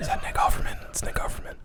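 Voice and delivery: hushed, breathless murmur of recognition; audience whisper. Retold or imitated. Imitating a cinema audience recognizing an actor, whispered. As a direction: whisper it like a cinema crowd noticing him. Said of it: "hear the audience kinda go".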